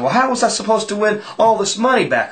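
high-pitched mock complaint; acted protest. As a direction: protest in a high voice as the cheated loser. Loser protests at being cheated.

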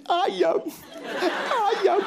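high mock-sobbing wife's voice; crying wife. Wife to husband, crying: high mock-sobbing "Ayam, ayam". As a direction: whimper the words out in a hammed-up cry. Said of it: "Sambil nangis".

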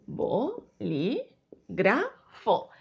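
one syllable at a time. Shown as a demonstration. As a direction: break the word up with pauses. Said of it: "Si dividimos las sílabas".

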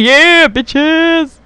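high gleeful shout; triumphant whoop. Real, not acted. Streamer cheering his unit's win, high triumphant yell. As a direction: cheer the win like a victory cry.

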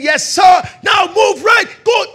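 loud, high drill commands; coach shouting. Retold or imitated. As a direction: yell the moves across the court.

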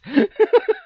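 a short burst of high laughter; real amused laugh. Real, not acted. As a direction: laugh out loud at the idea. Laughs at his own plan.

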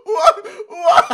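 high, loud disbelieving What; bursting out in shocked laughter; 1 laughing disbelief. Real, not acted. Reacting to old video clip.

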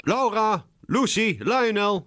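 high, loud, impatient summons; impatient calling. A narrator's reading. McDonald summons the dawdling cats to leave, impatient calling. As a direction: call each name out, urging them to hurry.

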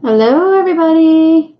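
loud, bright sing-song hello stretched on everybody; cheery livestream greeting. Real, not acted. Streamer greeting her live viewers, loud and cheery. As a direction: greet the whole chat with a big wave in the voice.

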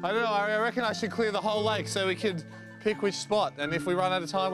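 ordinary talk

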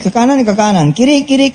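ordinary talk

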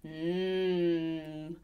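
low, soft, long "mm"; licking chops. A narrator's reading. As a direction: hum low like a hungry dingo. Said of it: "he's licking his chops".

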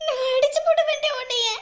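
loud anguished crying plea. Acted. Husband wailing over his dead wife, loud crying. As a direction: cry out at her to get up.

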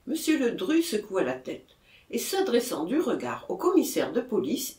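ordinary delivery

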